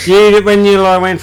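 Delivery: loud, disbelieving burst; practically shouting in disbelief; incredulous. Real, not acted. Co-host reacting to the horse story, loud disbelief.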